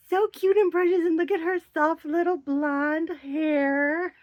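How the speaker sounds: cooing. Real, not acted. Cooing over the doll's details.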